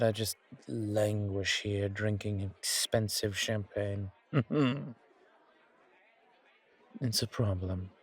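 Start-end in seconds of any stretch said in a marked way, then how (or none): 4.3-4.9 short, higher closed-mouth chuckle; wry chuckle. Acted. Vampire languishing with champagne, short wry chuckle. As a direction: chuckle briefly at your own plight.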